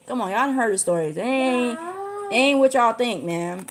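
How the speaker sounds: ordinary talk, nothing marked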